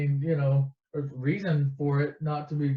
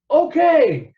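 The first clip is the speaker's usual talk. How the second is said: loud, high comic turnaround; suddenly all in, pitch leaping; eager agreement; 10 semitones higher, 9 dB louder than usual. Retold or imitated. Han won over by the money, eager.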